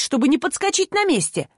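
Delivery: ordinary delivery, nothing marked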